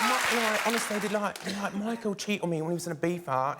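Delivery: gossiping in a Towie-style Essex accent. Shown as a demonstration. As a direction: imitate an Essex reality-show star. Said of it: "do their accent a little bit".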